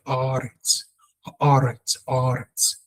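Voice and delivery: "Aretz" with a tongue-tip R; vibrating R. Shown as a demonstration. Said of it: "your tongue has to be rounded off and it must vibrate".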